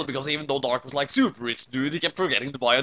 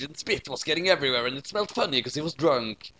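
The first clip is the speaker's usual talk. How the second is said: plain reading voice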